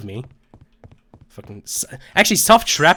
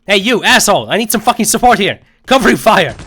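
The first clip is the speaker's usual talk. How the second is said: shouting; 7 semitones higher, 8 dB louder than usual. Real, not acted. Shouting for support.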